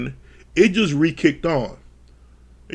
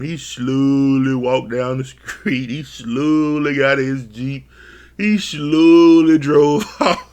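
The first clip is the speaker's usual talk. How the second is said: mock slow; 3 semitones lower, slower than usual. Comic slow narration of exit.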